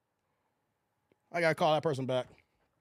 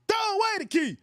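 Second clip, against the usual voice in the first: defiant shout; 7 semitones higher than usual. Real, not acted. Defiant boast to the chat.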